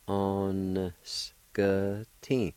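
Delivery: "On s k t" said very slowly, every sound stretched; slow careful pronunciation. Shown as a demonstration. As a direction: drag "On s k t" out slowly for learners. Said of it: "like this".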